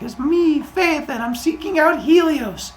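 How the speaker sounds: put-on very high, loud little-boy squeal; frightened boy. Frightened boy answers booming god.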